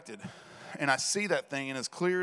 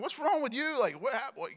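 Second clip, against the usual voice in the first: high, exasperated demand; frustrated; 11 semitones higher than usual. Retold or imitated. Frustrated father questioning his anxious son. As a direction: snap at a scared kid out of frustration. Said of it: "I was getting a little frustrated".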